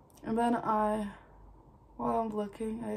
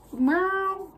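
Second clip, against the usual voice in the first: high, loud, drawn-out cartoon squawk; cartoon character voice; 10 semitones higher, 7 dB louder than usual. Real, not acted. Vlogger's voice slips out oddly mid-tutorial, cartoon character voice. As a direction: let it slip out like a cartoon. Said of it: "cartoon character voice".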